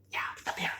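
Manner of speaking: secret whisper; hushed storyteller reveal. Pretend. Suspenseful reveal aside.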